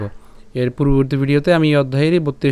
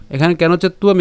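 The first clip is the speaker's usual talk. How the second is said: ordinary talk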